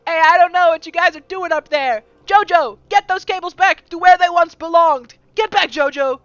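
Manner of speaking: furious yelling boss, very loud and high; angry shout. Acted. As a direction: bellow it at Jojo from the stage. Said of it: "He says".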